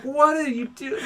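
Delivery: mock-baffled at a panicking friend; baffled question. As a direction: drag it out in disbelief. Retold baffled reply, laughing.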